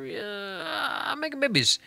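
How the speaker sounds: sudden squeaky high voice. Real, not acted. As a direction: jump way up in pitch. Villagers breeding.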